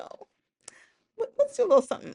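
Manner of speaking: coaxing tempter. Retold or imitated. Voicing [name] tempting women.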